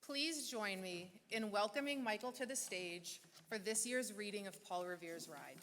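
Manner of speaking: usual speaking voice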